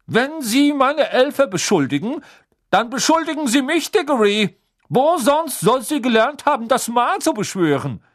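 high, loud, furious official; angry official. A narrator's reading. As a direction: hurl it back in accusation. Crouch accuses Diggory.

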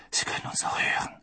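hushed warning whisper; voiceless, secretive hiss of warning; urgent whisper. A narrator's reading. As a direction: whisper so nobody overhears. Hiding, afraid of being overheard.

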